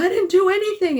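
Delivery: high, loud retold cry of innocence; indignant protest. Husband on phone insisting to wife, high loud indignant denial. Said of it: "And he's like".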